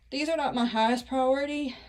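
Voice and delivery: normal talking voice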